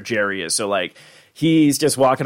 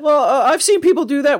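put-on much higher, unsure teen voice; 5 dB louder than usual. Imitating Morty answering Rick, high and hesitant. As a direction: squeak it out hesitantly.

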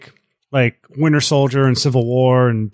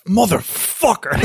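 drawn-out, higher-pitched exasperated swear; mock cursing; 6 semitones higher, slower than usual. Friend retelling how he got trolled, mock cursing. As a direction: stretch out the curse in playful outrage. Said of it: "I was, like, cursing".

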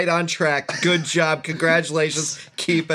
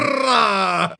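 rolled, trilled exclamation; rolled "brrrah" whoop; 5 semitones higher, 4 dB louder than usual. Acted. Co-host joking about Jamaican accents, trilled whoop.

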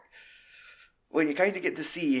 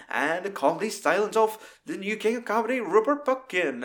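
grand announcer intro, pitched up; mock announcer; 7 semitones higher, 3 dB louder than usual. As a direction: hype up the new king of comedy.

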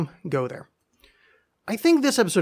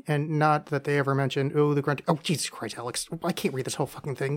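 normal talking voice

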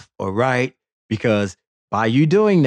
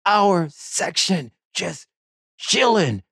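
indignant; 9 semitones higher than usual. Real, not acted. Indignant about seat takers.

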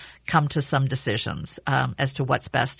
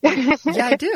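laughing; 9 semitones higher, 5 dB louder, slower than usual. Retold or imitated. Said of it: "I'm like".

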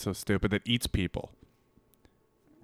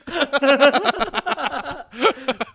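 long high-pitched laughter; 13 semitones higher than usual. Real, not acted. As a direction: crack up hard. Laughing at the reply.

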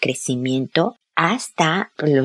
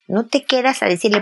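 usual speaking voice

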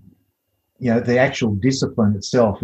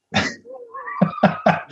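laughing. Real, not acted. Laughs after his view.

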